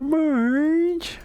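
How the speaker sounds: quick Homer Simpson voice; Homer Simpson impression. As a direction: call Marge like Homer. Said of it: "can you do Homer Simpson".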